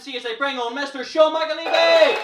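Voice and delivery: high, full-force MC introduction; big rising stage announcement; announcer shout. Real, not acted. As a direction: belt out the name to bring on the act.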